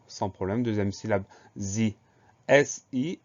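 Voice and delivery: ordinary delivery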